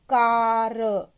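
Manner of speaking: कार stretched long and loud, mouth wide open on the aa; long open aa vowel; drawn-out open ah. Shown as a demonstration. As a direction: hold the aa in कार slow and open. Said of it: "जब हमारा मुंह खुलेगा तभी".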